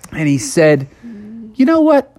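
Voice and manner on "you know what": lightly voiced perky frog, pitched high; higher character voice, lightly acted; Froggy's voice. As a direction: pipe up with a bright idea.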